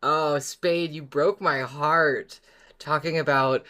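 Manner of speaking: ordinary talk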